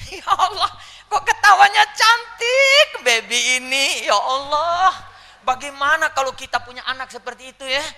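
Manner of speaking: high, loud, stretched-out exclamations; gushing admiration. Acted. Mother marvelling at newborn's pretty laugh, gushing high. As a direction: gush over the baby, hammed up.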